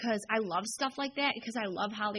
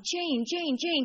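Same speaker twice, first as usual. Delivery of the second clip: mock fan calling a name from above, raised and higher; half-hearted retold shout of a name; 5 semitones higher, 3 dB louder, much slower than usual. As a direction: call her name like a fan up in the balcony. Fans yelling down from balconies.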